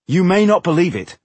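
loud, high interjection; cutting in. A narrator's reading. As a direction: break in to argue back. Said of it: "Tungdil cut in".

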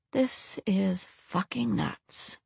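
slow, hushed disbelief; inner thought. A narrator's reading. Man thinking to himself before acting, hushed. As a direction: think it aloud, very softly. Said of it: "he thought".

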